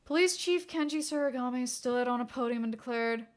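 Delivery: ordinary delivery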